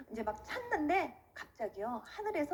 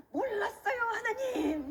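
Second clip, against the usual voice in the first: high-pitched wailing plea, drawn out; tearful begging; 7 semitones higher, slower than usual. Retold or imitated. New believer begs God for forgiveness, tearful re-enacted plea. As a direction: plead like a child begging parents. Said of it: "하나님한테 막 이렇게 빌기 시작했어요".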